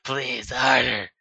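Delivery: slow, loud pleading character voice; breathless slow plea; begging lover. Acted. Lover begging during love scene.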